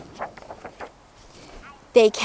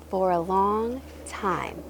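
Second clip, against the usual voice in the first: usual speaking voice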